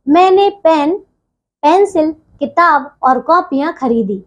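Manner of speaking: short halts after "pen" and "pencil", then finish the sentence; comma pauses in list. Shown as a demonstration. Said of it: "जहां थोड़ी देर ठहरना हो".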